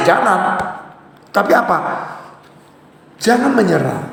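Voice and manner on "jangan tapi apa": ordinary delivery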